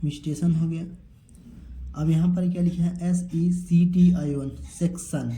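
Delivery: usual speaking voice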